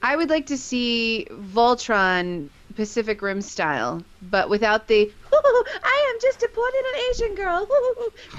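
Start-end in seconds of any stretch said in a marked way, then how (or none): 5.1-8.4 high, put-on girlish voice; hammed-up mock damsel; mocking movie girl voice. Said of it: "kind of bullshit that goes on in that".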